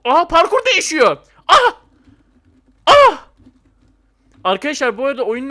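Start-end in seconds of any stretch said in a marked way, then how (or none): 0.0-3.3 high loud alarmed yelps; shocked shouting; startled cries. Real, not acted. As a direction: cry out as the course shifts. Course changes, player falls.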